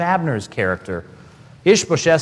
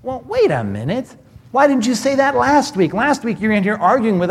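incredulous challenge; 6 semitones higher than usual. Retold or imitated. Elders challenging Abner's switch.

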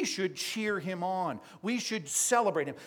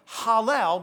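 loud, raised cry of praise; shouting; 5 semitones higher, 8 dB louder than usual. Acted. Preacher voicing everybody shouting in heaven, loud raised call. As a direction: call it out like a cheering crowd. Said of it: "everybody's shouting".

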